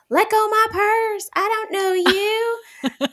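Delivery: high, slow, loud cartoon-kid yell; Bobby yelling the line. Friend retelling King of the Hill, yelling as Bobby. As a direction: belt it out like a kid taught a self-defense chant. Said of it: "they teach him to say".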